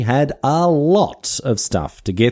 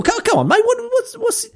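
much higher, squeaky affronted protest; mock indignation. Host scolds Darwin for knocking Australian trees, in hammed-up high-pitched indignation. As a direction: tell Darwin off like an offended local.

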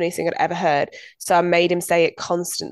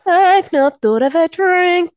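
loud, high, drawn-out admission; hammed-up confession; 11 semitones higher, 8 dB louder, slower than usual. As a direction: announce your failure with mock pride. Hammed-up confession after prompting.

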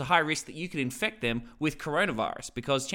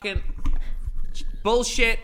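mock angry curse; 8 semitones higher, 6 dB louder than usual. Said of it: "I'll play Tom".

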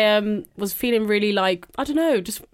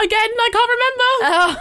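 laughing; 13 semitones higher, 4 dB louder than usual. Real, not acted. Laughing at forgetting her week.

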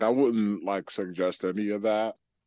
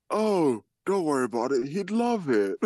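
high, mock-casual voice; high mocking voice of the creep; mocking the dude; 7 semitones higher, 3 dB louder than usual. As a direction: imitate him breezily brushing it off. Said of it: "The dude's like".

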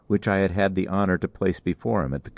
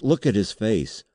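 urgent, raised-pitch accusation; higher, insistent exclamation; exclaiming; 6 semitones higher than usual. A narrator's reading. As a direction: call everyone's attention to him. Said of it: "I exclaimed".

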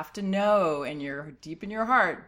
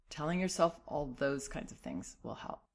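ordinary talk

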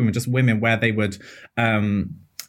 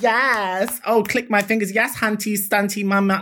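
higher, sassy fake drag-queen slang; mock drag queen talk; 6 semitones higher than usual. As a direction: parody posh women playing drag queen. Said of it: "talking like a drag queen to you".

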